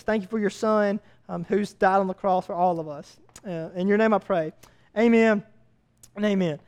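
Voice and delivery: soft, low opening prayer. Real, not acted. Pastor praying over the church, soft and low. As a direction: pray it quietly to God before preaching. Pastor prays before sermon.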